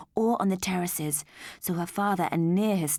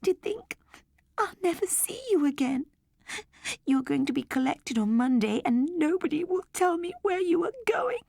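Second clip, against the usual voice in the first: put-on high, tearful little-girl voice; a child close to crying; 10 semitones higher than usual. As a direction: plead through rising tears. Girl grieving over robot teddy.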